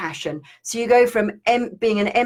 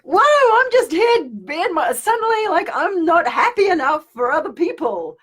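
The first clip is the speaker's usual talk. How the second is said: mock surprise; 9 semitones higher, 3 dB louder than usual. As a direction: act stunned at the scolding. Mocking herself being scolded.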